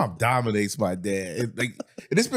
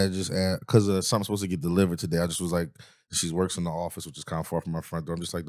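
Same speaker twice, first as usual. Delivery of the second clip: ordinary talk, nothing marked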